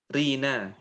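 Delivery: ordinary talk, nothing marked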